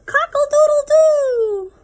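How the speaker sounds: high, loud crowing cockle-doodle-doo; rooster crow. Pretend. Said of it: "He can sound like a rooster".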